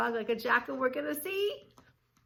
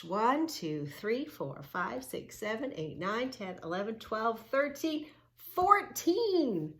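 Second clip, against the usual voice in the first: searching count; 4 semitones higher than usual. Shown as a demonstration. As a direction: count like hunting for each turtle.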